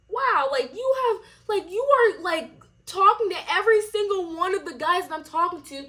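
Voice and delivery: calling her out in total disbelief; incredulous accusation. Retold or imitated. Retold accusation of the girl.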